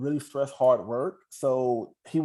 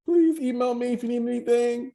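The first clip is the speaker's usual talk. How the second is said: laughing; 11 semitones higher, 4 dB louder than usual. Real, not acted. Laughs during goodbye.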